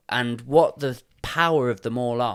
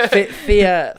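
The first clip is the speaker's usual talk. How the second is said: higher, louder, laughing through fear; 6 semitones higher, 10 dB louder than usual. Real, not acted. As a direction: giggle through the word. Laughing while restarting word.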